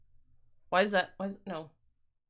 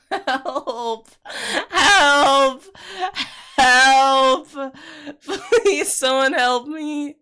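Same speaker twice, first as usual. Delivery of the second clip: mock cry for help; 5 semitones higher, 7 dB louder, much slower than usual.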